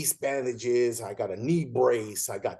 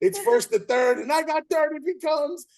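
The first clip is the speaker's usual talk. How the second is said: high, loud rush of panic; mock panic at the base; frantic retelling; 13 semitones higher, 5 dB louder than usual. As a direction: blurt it like the play is on you. Frantic moment in retold play.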